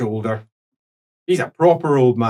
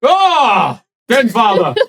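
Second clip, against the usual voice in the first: startled loud correction; 8 semitones higher, 5 dB louder, slower than usual. Acted. Priest corrects title loudly.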